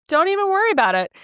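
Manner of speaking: high, airy don't-worry voice; breezy reassurance. Retold or imitated. Scene: reassuring the upset restaurant girl, airy and high. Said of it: "the way I'm saying it".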